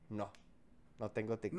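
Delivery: very low, barely audible confession; pained admission. Retold or imitated. Admitting to youngsters he has no TikTok, tiny and pained. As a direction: shrink the voice down, embarrassed. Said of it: "sabes qué es lo doloroso? Decirles".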